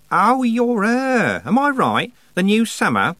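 high, loud, drawn-out realisation; delighted recognition. A narrator's reading. Goddess recognising Tiffany as the new summer, excited. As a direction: pounce on it, pitch soaring.

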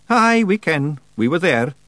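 high, quick Scottish pixie voice; cheeky little Scots warrior; Scottish Feegle voice. A narrator's reading. Feegle Rob Anybody speaks.

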